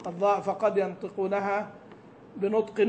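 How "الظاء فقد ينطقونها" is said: normal talking voice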